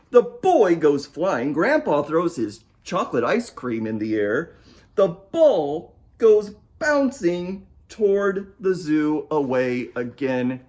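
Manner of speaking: usual speaking voice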